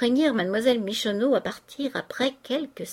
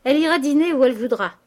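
fast, loud, raised cry; shouted retort; 5 semitones higher, 7 dB louder, faster than usual. A narrator's reading. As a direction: shout it quickly over the others. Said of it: "crièrent plusieurs voix".

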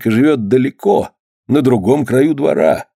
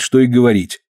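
plain reading voice

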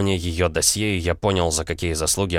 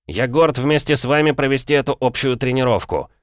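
loud, high rallying address; rally the soldiers at full voice; rousing speech to troops; 7 semitones higher, 4 dB louder than usual. Acted.

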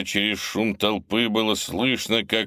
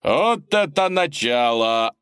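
slow, loud, high delighted shout; excited announcer; 6 semitones higher, 4 dB louder, slower than usual. Acted. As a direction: marvel at the start of the fight. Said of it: "восхитился ведущий".

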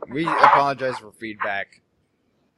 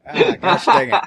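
laughing; 12 semitones higher, 9 dB louder than usual. Real, not acted. Laughing at own slip.

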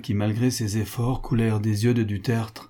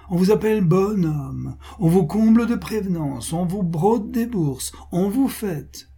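high, singsong mock flattery with falling ends; sardonic Pascal; 7 semitones higher, 4 dB louder than usual. Pascal mocking those who flatter then ask favours, sardonic. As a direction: parade each compliment with exaggerated sweetness.

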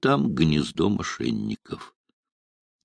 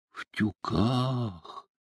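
soft, drawn-out dismayed question; quiet, stretched-out disappointment; 3 semitones higher, 11 dB quieter, much slower than usual. A narrator's reading. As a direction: echo it slowly, crestfallen. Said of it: "огорчился Машин".

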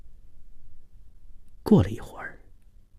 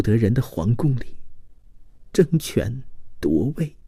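plaintive, higher woman's voice; sorrowful, loving woman; 7 semitones higher than usual. Acted. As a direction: pour out long-held longing tearfully. (女子含泪哀婉地倾诉)

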